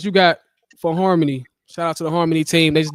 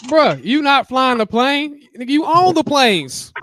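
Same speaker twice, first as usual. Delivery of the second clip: high, loud, drawn-out disbelief; incredulous objection; 10 semitones higher, 7 dB louder, slower than usual. Real, not acted. Friend challenging a man over his own planes, loud incredulity. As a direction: protest loudly, stretching the words out.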